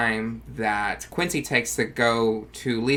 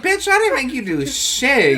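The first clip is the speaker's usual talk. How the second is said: mock snap; 11 semitones higher, 3 dB louder than usual. [name] snaps at Quincy.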